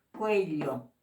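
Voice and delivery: ordinary talk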